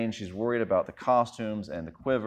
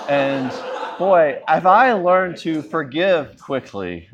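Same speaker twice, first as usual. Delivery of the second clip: mock proud; 5 semitones higher, 9 dB louder, slower than usual. Real, not acted. Ironic self-praise.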